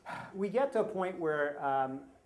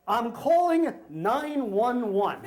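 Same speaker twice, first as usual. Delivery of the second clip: slow, raised mock yell; retold yell; 4 semitones higher, 4 dB louder, much slower than usual. Power-walking woman threatens dog owner, slow mock yelling. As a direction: imitate her threat, each word drawn out.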